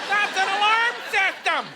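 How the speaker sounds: high, slow yelled line; retold yell. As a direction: yell it again, drawn out. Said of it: "I had a lady yell out".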